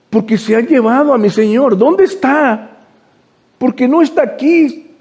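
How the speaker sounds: high, pleading voice of a weeping woman; crying Mary. Retold or imitated. Mary asks where her Lord's body was taken, tearful pleading. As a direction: plead like Mary at the empty tomb. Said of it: "ella está llorando".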